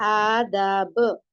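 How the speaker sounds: slow आदाब with long aa vowels; syllable-by-syllable reading. Shown as a demonstration. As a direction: draw out आदाब sound by sound. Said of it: "ध्यान दे और आवाज देखे".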